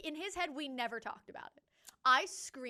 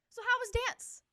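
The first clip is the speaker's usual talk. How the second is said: higher, casual innocent question; mock clueless boyfriend; 11 semitones higher, 4 dB louder than usual. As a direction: ask it breezily, high and innocent. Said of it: "looks at me and goes".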